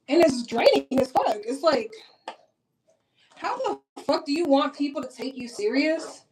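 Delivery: ordinary delivery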